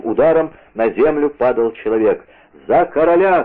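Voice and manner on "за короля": higher, raised rallying cry; shouted battle cry. A narrator's reading. D'Artagnan striking for the king, shouted battle cry. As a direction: cry out with each blow. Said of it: "кричал Д'Артаньян".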